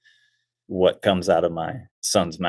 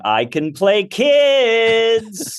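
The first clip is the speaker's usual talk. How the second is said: very high, long-drawn desperate pitch; mock pleading; 12 semitones higher, 4 dB louder, much slower than usual. Performer going round offices for parts, hammed-up pleading. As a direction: beg in a hammed-up, stretched high call.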